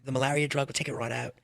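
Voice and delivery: put-on botched British accent with an Australian slant; lightly put-on British accent; attempted British accent. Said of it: "he's British".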